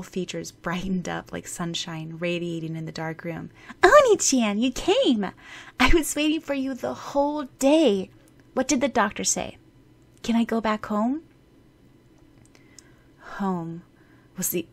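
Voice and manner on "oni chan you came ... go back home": put-on high, eager little girl greeting her brother; a sick child overjoyed to see him. Erika's excited greeting to her brother.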